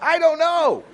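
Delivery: very high, loud helpless wail; helpless panic. Retold or imitated. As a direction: break upward in bewildered fear. Retelling his confused panic attack.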